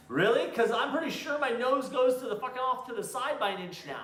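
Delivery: high, loud sarcastic comeback; sarcastic retort. Retold or imitated. Retelling telling his wife off about the nose, high sarcastic. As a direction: throw it back at her with mock disbelief. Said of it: "I'm like".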